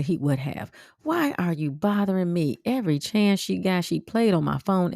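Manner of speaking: usual speaking voice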